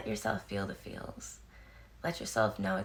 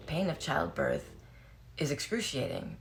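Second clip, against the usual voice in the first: ordinary delivery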